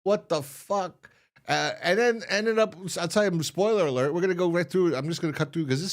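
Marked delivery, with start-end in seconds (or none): 0.0-1.1 slow, higher-pitched disbelief; stunned disbelief. Retold or imitated. Podcaster voicing Rick's reaction to the plan, stunned. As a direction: draw it out, acting dumbfounded.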